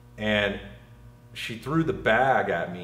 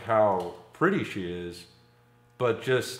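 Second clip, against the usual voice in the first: normal talking voice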